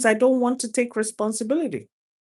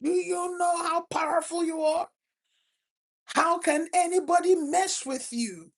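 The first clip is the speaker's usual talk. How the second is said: fervent mentor; 8 semitones higher than usual. Retold or imitated. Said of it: "he will go on and on".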